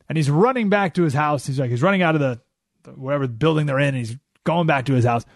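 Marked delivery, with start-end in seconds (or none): none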